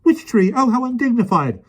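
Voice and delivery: very high fretting lady; flustered panic. A narrator's reading. Miss Lark panics at the beast, very high fluster. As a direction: fret helplessly.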